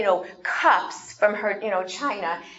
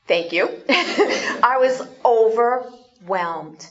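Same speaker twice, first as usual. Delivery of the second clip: normal talking voice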